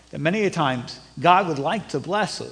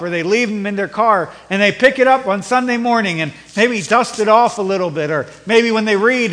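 raised, louder preaching voice; fervent sermon delivery; 4 semitones higher, 6 dB louder than usual. Acted. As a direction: preach it out to the congregation. Preaching builds in intensity.

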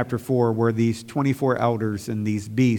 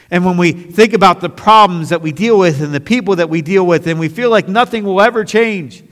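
louder, higher, driving sermon voice; full-force pulpit preaching; fiery preaching; 8 semitones higher, 12 dB louder than usual. Acted. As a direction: preach it hard to the pews.

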